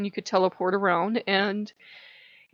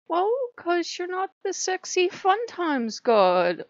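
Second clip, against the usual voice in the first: high, slow, drawn-out hedging; mock awkward explanation; 11 semitones higher, slower than usual. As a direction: stretch it out high, sheepishly explaining. Acting out awkward explanation to god.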